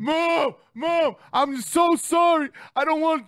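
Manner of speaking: put-on high, loud wailing kid; hammed-up bawling plea to mom; mock crying. Crying kid begging mom on the way back, wailing. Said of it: "crying the whole way".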